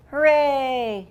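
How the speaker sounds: loud cheering hooray. Acted. Boy lands on giraffe, loud cheer. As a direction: cheer the lucky landing.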